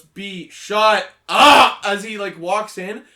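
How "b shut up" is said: loud, drawn-out yell at his sister; brother yelling. Retold or imitated. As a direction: bark it through the door. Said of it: "opens the door and goes".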